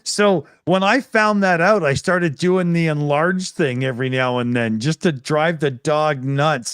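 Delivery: ordinary talk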